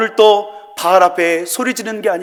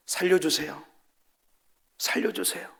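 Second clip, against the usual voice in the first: softer, near-whispered cry for help; whispered plea; 11 dB quieter than usual. Shown as a demonstration. Preacher mocks a timid prayer as a whisper: faint whispered plea. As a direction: whisper help me as if ashamed to ask. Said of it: "귓속말로".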